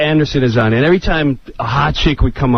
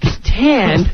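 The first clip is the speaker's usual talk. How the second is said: usual speaking voice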